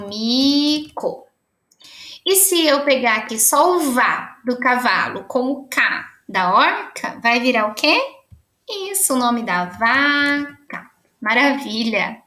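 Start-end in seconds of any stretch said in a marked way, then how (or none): 0.1-1.3 "mico" with the first syllable held, then a gap; teacher revealing "mico" syllable by syllable; drawn-out syllables. Shown as a demonstration. Joins circled syllables into a word. 9.7-10.9 "vaca" with the first syllable held, then a gap; teacher revealing "vaca" syllable by syllable; drawn-out syllables. Shown as a demonstration. Joins circled syllables into a word.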